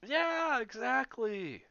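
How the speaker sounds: high, loud, drawn-out yes; excited agreement. Real, not acted. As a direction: shout your agreement.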